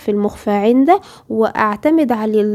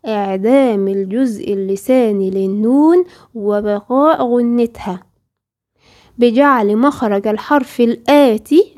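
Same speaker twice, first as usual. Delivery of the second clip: dictation pace; slower than usual. Shown as a demonstration. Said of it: "اكتبي ورايا الاصطلاح كده".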